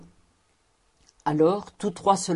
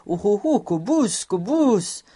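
astonished cries, a little raised; swelling amazement; 3 semitones higher, 4 dB louder than usual. A narrator's reading. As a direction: exclaim the name in growing wonder. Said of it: "Et sa voix se renflait, de plus en plus ébahie".

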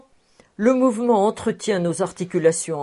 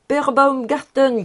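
calling out; 6 semitones higher, 5 dB louder than usual. A narrator's reading. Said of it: "criait le professeur Speck".

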